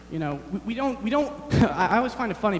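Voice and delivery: usual speaking voice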